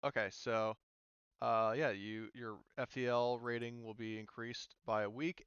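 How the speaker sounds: usual speaking voice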